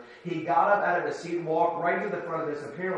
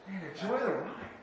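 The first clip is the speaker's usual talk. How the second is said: quiet callback; 3 semitones higher, 6 dB quieter than usual. Real, not acted.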